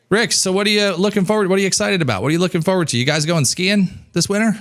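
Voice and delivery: high, energetic chat; host building rapport with guests; lively host voice. Shown as a demonstration. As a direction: keep the guests talking with energy. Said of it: "acted rapport-building call".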